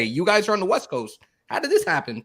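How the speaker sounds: high, incredulous replay of his own shock; amazed disbelief. Retold or imitated. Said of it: "I'm like".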